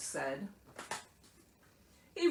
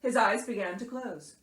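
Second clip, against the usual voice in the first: ordinary delivery, nothing marked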